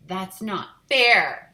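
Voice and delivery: very loud, slow whine; loud complaint. Acted. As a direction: protest loudly. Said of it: "Susie complained".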